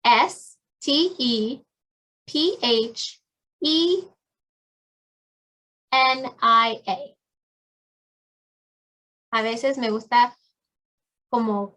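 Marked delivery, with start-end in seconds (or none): 0.0-7.2 letters spelled slowly one by one; slow spelling. Shown as a demonstration. Teacher spelling her name slowly, letter by letter. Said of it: "This is how I spell my name".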